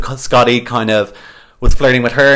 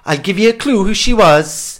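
bad Welsh accent, pitched up; 8 semitones higher than usual. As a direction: put on a hammy Welsh lilt. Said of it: "putting on the Welsh accent".

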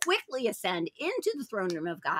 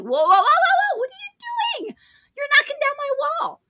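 high, loud acted objection; alarmed protest; 6 dB louder, faster than usual. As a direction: stop the wreckers in a mock panic. Acted homeowner protesting at demolition.

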